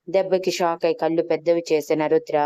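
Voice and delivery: ordinary delivery, nothing marked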